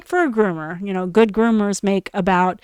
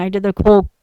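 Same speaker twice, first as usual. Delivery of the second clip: ordinary delivery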